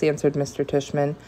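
ordinary delivery, nothing marked

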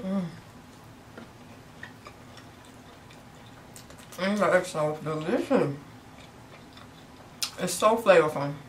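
chewing while talking; speaking around food; mouth full. Real, not acted. Seen 2: Bites food at -7 to -4; lips pursed, mouth working through words.

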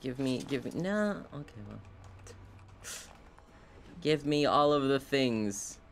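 usual speaking voice

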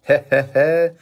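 read-out gloating "heh heh heh"; acted chuckle. A narrator's reading. Brother gloating behind Kyle, chuckling. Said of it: "chuckled Mike behind Kyle".

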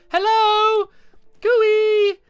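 high, loud hail across the distance; calling out. Real, not acted. Calling to distant moon figures, loud and high. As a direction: shout over to the strangers.

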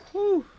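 relieved whew. Real, not acted. Relief after intense round.

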